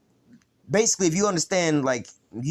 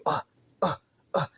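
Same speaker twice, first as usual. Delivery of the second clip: short grunts of someone being beaten; imitated grunts. Preacher imitating a beaten man, short separated grunts. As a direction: mimic taking punches, one grunt each. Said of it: "you hear him".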